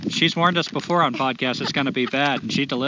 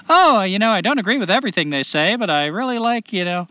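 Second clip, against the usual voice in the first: higher-pitched mimicry of a hedging fan; hedging reviewer; 7 semitones higher, 4 dB louder than usual. Co-host imitating listener reviews with caveats, mock hedging. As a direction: imitate a reviewer apologetically qualifying their praise.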